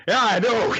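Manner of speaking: high, loud mock outburst; exasperated retort. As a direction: blurt it out, exasperated. Ren exasperated by the obvious.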